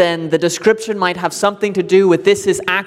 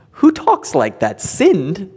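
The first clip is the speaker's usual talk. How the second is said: mock incredulity; slower than usual. Neighbour reacting to puritanical language, mock incredulous. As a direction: play a neighbour balking at an outdated word.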